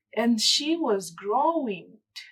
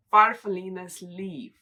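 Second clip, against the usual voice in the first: ordinary delivery, nothing marked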